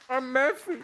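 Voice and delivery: muffled through a mouthful; mouth full. Real, not acted. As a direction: still chewing while talking. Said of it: "Don't choke".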